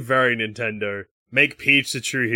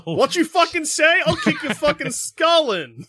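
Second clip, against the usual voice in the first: much higher and slower, hammed-up threat; high, slow mock tough Peach; slower than usual. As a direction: play tough Peach squaring up, sky-high.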